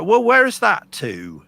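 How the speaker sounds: put-on high, loud Bristol accent. Musician imitating how locals talk, Bristol accent. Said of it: "the Bristolians will go".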